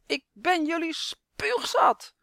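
slow, high, loud furious yell; furious scream. A narrator's reading. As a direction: scream it all out in rage. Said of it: "en hij gilt".

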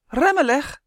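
high, loud cry to brake; roepend. A narrator's reading. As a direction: yell for the runner to stop. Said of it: "riep Justin". (luid en dringend roepen)

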